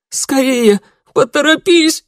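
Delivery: very high, loud shriek; desperate scream. A narrator's reading. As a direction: scream at him to hurry. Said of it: "выкрикнула она". (отчаянный пронзительный крик)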